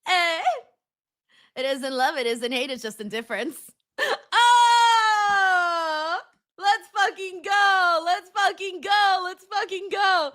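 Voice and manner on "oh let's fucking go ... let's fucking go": long drawn-out triumphant yells; hype cheer. Real, not acted. Streamer reacting to a savage chat message, shouting with joy. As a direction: roar it out, pure celebration.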